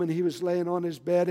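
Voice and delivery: ordinary talk, nothing marked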